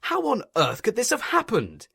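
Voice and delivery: high, dismayed cry. A narrator's reading. Inspector finding the wrecked trains, high dismayed cry. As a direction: throw the question up in dismay, pitched high. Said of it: "the inspector cried".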